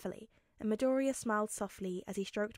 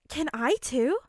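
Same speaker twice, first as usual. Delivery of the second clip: eager; 4 semitones higher, 6 dB louder than usual. Acted. Girl eagerly asks to touch.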